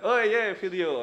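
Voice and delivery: playful fake excitement over a video clip; excited. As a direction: hype it up like a fan who just spotted a video. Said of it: "So be excited that".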